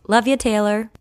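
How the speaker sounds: ordinary talk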